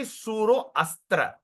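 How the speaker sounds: suro astra as two words with the A sounded; split pronunciation. Shown as a demonstration. Teacher shows what not to say, split-word demo. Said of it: "So you don't say".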